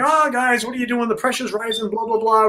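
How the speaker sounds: high-pitched, worried friend's warning; Barry fretting. Retold or imitated. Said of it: "He's like".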